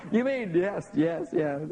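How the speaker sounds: playing both parents in disbelief; mock parent exchange. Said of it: "they go".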